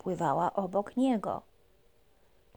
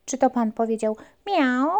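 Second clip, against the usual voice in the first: ordinary delivery, nothing marked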